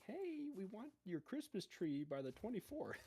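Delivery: high mock official demand; IRS official. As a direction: play a pushy tax official. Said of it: "the I R S saying".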